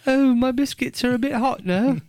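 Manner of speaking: high, hammed-up English lady; mock English accent. Playing an English woman swooning over accents.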